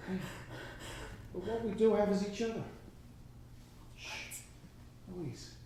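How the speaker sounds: tender. Acted. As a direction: comfort her gently. Man tenderly proposing to her.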